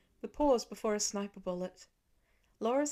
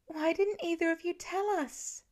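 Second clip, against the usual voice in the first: much higher, hurt and accusing; upset demand; 9 semitones higher than usual. A narrator's reading. Lila demands why her parents kept it secret, upset. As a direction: press them for the truth.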